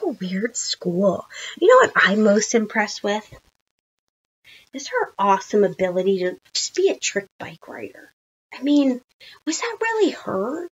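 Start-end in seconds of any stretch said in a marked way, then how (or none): none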